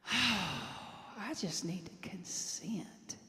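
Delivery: slow, sighed Southern drawl; Southern accent. Retold or imitated. Quoting a prayer partner's realization, slow Southern accent. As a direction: imitate a Southern friend, hushed and drawn out. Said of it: "in his beautiful Southern accent".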